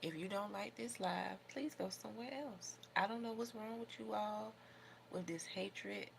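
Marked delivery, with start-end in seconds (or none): none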